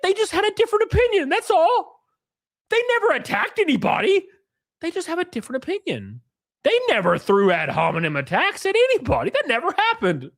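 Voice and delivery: high, sing-song mockery of their excuses; mock innocent voice. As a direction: play the innocent, sneering underneath. Mocking their claimed innocence.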